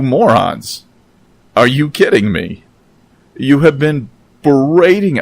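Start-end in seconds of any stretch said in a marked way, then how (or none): 3.4-5.2 rising anger, raised and louder; getting angry. Real, not acted. Vlogger snapping at the media critics, getting angry. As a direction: start to lose your temper at the critics. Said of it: "I'm getting angry".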